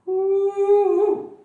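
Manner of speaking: loud, high victory whoop; triumphant woo. Real, not acted. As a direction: celebrate the last rep. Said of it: "finishing sit-ups".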